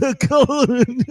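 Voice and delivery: high, slow, wobbling mock voice; garbled put-on voice. Man mimics watcher's changed talk, garbled put-on voice. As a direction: imitate him with a juddering, stretched-out warble. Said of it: "starts talking like this".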